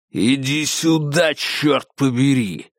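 slow, menacing growl; growled command. Acted. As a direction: growl it as a threat. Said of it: "прорычал Конгдон". (рычащий приказ)

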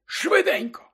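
very high, excited character voice; eager headmaster. A narrator's reading. Dumbledore urging Harry to see the memory, eager. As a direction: urge him on in a high, drawn-out voice.

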